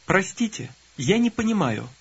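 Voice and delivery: ordinary talk, nothing marked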